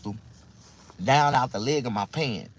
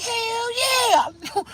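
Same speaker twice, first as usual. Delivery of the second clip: very high, loud elated cry; re-enacted overjoyed holler; thrilled whoop; 5 dB louder than usual. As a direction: shout it like you hit the jackpot. Acts out his thrilled reaction.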